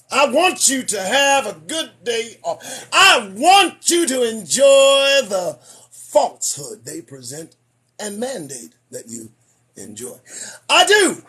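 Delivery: slow sermon build then drop; preaching. Acted. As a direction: preach the warning slowly. Mock sermon to viewers.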